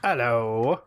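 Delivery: hello in a Cockney accent. Real, not acted. Cohost greeting the listeners, slipping into Cockney. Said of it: "why did I go Cockney again".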